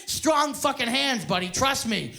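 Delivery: usual speaking voice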